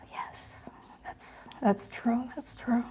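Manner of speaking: a hushed, touched yes; soft whisper. Real, not acted. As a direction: murmur it under your breath. Quietly agreeing with a comment.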